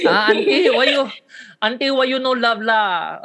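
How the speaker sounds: Malaysian auntie voice. Pretend. Teasing a friend as a Malaysian auntie, laughing. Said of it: "Malaysian accent".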